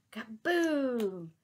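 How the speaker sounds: loud booming kaboom; explosion. A narrator's reading. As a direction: boom it out. Explosion sound.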